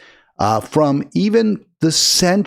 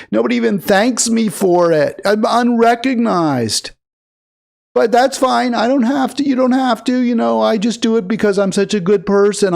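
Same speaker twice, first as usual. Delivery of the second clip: higher whiny martyr voice, sarcastic; mock self-pity; 6 semitones higher than usual. The host parodies the congresswoman grovelling and bragging, in a whiny high voice. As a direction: play her as a self-pitying martyr. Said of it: "She's like".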